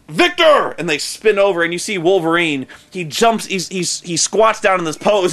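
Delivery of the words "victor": mock shout. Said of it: "he's like".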